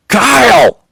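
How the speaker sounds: very high, loud shriek of a name; shout. A narrator's reading. Chloe shouting at the driver, shrieking.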